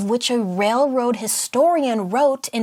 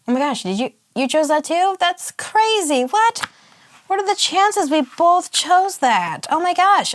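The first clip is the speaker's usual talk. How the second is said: high excited disbelief; surprised; 9 semitones higher, 4 dB louder than usual. Pretend. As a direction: squeal with amazed surprise. Mock surprise, same choice.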